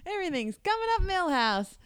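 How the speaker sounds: high, excited Millhouse line; Millhouse quote. Retold or imitated. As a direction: belt out the triumphant catchphrase. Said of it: "then he says".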